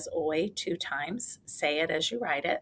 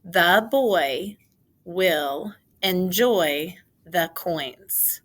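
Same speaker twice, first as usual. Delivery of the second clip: put-on The boy will enjoy the coins said slow and louder with the class; slow choral reading; 6 dB louder, much slower than usual. Shown as a demonstration. Teacher reading the sentence slowly with students. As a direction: read it slowly together. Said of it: "Say it with me".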